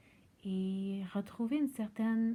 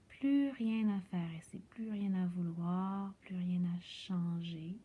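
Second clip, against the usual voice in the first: guided meditation. Acted. Yoga teacher guiding listeners lying on their backs into rest, guided meditation voice.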